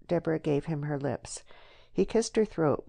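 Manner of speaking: ordinary delivery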